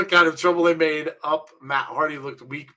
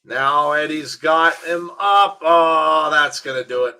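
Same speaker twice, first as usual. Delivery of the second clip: wrestling commentator; 7 dB louder, slower than usual. Acted. Calls the wrestling match live.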